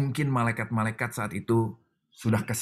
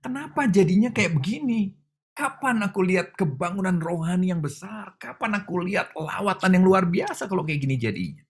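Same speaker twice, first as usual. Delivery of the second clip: much higher, aggrieved questions to God; complaining prayer; 9 semitones higher than usual. Retold or imitated. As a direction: plead your disappointment upward. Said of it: "Waktu saya bilang". (mengeluh kepada Tuhan dengan nada tinggi)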